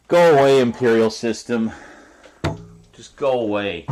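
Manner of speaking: loud mock yell; mock shout. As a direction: yell it at the imperial system. Shouting at the imperial system.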